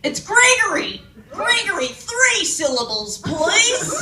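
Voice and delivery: mock-indignant bloke correcting his name; fussy Aussie bloke. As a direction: insist pedantically on the full name. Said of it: "Aussie guys do you know called Greg".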